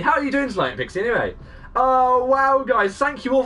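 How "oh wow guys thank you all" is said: high, loud wow easing into thanks; thrilled, grateful exclamation; overwhelmed delight. Real, not acted. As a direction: gush thanks in a raised voice. Overwhelmed by the raid.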